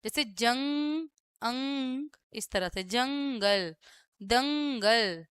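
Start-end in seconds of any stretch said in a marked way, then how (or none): 0.2-2.1 "जंग, अंग" lingering on the nasal; nasal anusvara. Shown as a demonstration. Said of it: "रुक करके ना नाक से बोलते हैं". 2.8-5.2 "जंगल, दंगल" slow, with a long nasal hum; nasal anusvara. Shown as a demonstration. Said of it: "रुक करके ना नाक से बोलते हैं".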